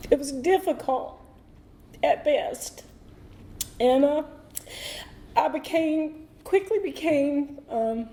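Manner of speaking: fighting back tears as she talks about home; choked up. Real, not acted. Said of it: "y'all excuse me if I get emotional".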